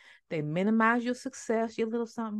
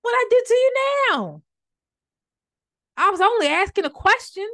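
much higher, loud comic protest; loud indignant retort; 11 semitones higher, 7 dB louder than usual. Acted. David protesting to his brother, loud, high indignation. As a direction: act out an exasperated comeback, pitched way up.

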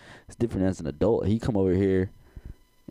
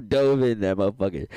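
usual speaking voice

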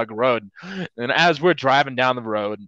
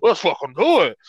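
put-on hyped nerdy kid; 6 semitones higher than usual. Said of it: "some nerdy little kids are like".

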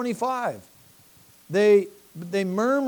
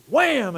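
loud wham; 6 semitones higher, 5 dB louder than usual. Retold or imitated. Moses smacks the rock.

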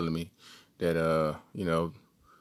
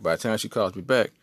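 ordinary talk

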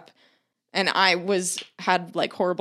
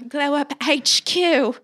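slow, higher, louder mock British announcer accent; exaggerated British accent; 6 semitones higher, 4 dB louder, slower than usual. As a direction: ham up a British TV-show accent, drawn out. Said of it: "aggressive, horrible accents".

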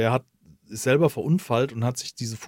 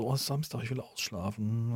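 sleepy mumble; 9 dB quieter than usual. Retold or imitated. Sleepy thought while woken by cat.

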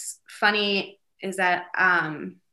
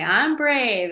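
higher, louder affirmation, falling at the end; mom leading affirmations; 6 semitones higher, 6 dB louder than usual. Retold or imitated. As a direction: call it out for a child to repeat. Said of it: "and I'll say".

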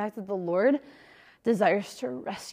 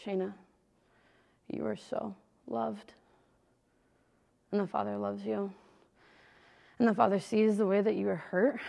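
soft, gentle priest comforting; lightly voiced priest, hushed and kind; tender priest; 5 dB quieter than usual. Priest to weeping penitent, gently soft. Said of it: "he very tenderly was like".

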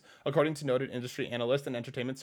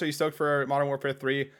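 ordinary talk

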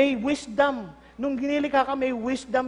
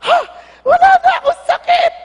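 much higher, far louder joyful yell with a laugh; overjoyed shout; 15 dB louder than usual. Pretend. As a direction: burst out laughing, just healed. Healed leper voiced, overjoyed. (masayang sigaw ng gumaling na ketongin)

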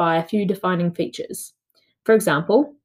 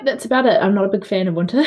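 ordinary talk

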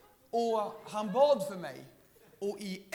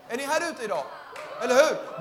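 louder and higher, rousing the crowd; preaching shout; 4 semitones higher, 10 dB louder, faster than usual. Acted. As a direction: preach it at full force to the hall. (predikande rop)

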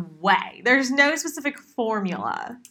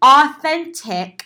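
"Authentic" slow and louder, in scare quotes; air quotes; 4 semitones higher, 7 dB louder, much slower than usual. Real, not acted. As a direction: sneer the word Authentic, drawn out. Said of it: "I'm doing that with air quotes in my hands".

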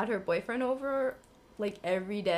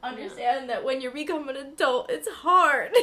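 mock scolding, rising to a loud finish; exasperated scold; 4 semitones higher, 3 dB louder than usual. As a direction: scold them like a fed-up adult. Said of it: "I'm like".

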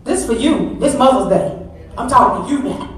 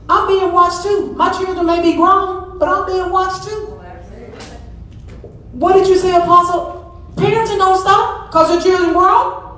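high, raised preaching voice; preaching climax; 7 semitones higher than usual. Acted. Preacher telling mothers that parenting never stops, high preaching. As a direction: climb high and press the point home.

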